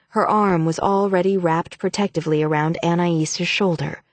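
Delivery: plain reading voice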